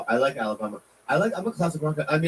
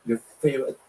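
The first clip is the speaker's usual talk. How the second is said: ordinary talk, nothing marked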